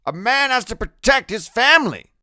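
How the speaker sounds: loud, stubborn grandfather; grandfather arguing. A narrator's reading. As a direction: argue back, short of a scream. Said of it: "Grim's voice isn't nearly as loud".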